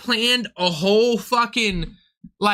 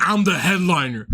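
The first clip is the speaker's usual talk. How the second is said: lower, lightly mocked self-important voice; imitating the headliner; 5 semitones lower than usual. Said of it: "He's like".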